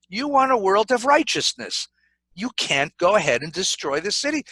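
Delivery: ordinary delivery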